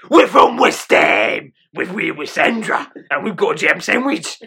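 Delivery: loud, drawn-out comic Cockney; Cockney accent. Pretend. Host opening the podcast as a joke, hammed-up Cockney accent. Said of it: "That's my Cockney accent".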